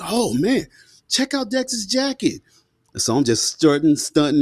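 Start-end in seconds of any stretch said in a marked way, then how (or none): 0.0-2.4 much higher and slow, wowed; impressed kids. Retold or imitated. Bus kids gawking at his new jacket, much higher, slow and wowed. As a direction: stretch it out like kids in awe. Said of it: "And they was like".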